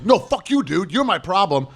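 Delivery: high, angry retort; cokehead snapping back. Retold or imitated. Cokehead telling off the bouncer, angry and high. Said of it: "And the guy's".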